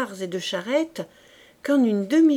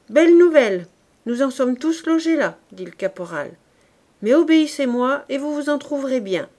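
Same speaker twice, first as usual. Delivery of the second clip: loud, higher sarcastic scolding; gruff rebuke; 4 semitones higher, 7 dB louder than usual. Acted. Corporal scolding his worn-out soldiers, loud and sarcastic. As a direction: scoff then command obedience.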